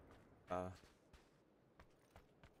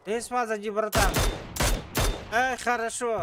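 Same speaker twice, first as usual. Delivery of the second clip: excited shouting; 9 semitones higher, 4 dB louder than usual. Real, not acted. Urging teammates to finish enemy.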